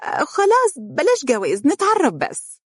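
put-on childish sulk. A narrator's reading. Wife pouting playfully at husband, high childish whine. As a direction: pout like a sulky child. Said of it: "بتبرم طفولي".

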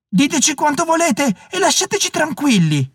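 high, sustained calling voice; ringing, high-pitched exclamation; stentorian cry. A narrator's reading. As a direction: call out high to unseen attackers. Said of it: "esclamò con voce stentorea".

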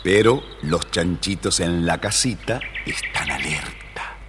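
usual speaking voice

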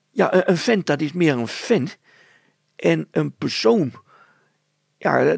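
put-on high, loud, tumbling girl's voice; a breathless girl blurting out what she saw; flustered girl. Petronella explains vent vs persoon.

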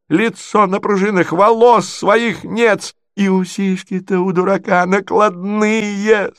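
high, slow, scolding screech; screeched insults. A narrator's reading. Marya lists prince's false parts, screeching high. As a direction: screech out each defect in turn. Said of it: "скрипила Марья Александровна".

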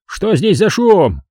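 high, slow mild shout; calling out. A narrator's reading. Neighbour disturbed at night, calling out. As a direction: demand what the racket is. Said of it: "услышал я крик мистера Маккола".

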